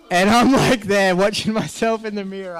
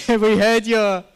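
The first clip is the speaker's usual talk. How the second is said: laughing through a quote; 4 semitones higher, slower than usual. Retold or imitated. Neighbor's quote breaks into laughter.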